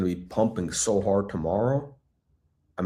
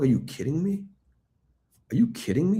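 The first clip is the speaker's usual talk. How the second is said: exasperated disbelief; 9 semitones higher, faster than usual. Real, not acted. Thousand page filing discovered.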